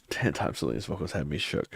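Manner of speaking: low murmured reread. Shown as a demonstration. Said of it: "repeated reading of chat comment".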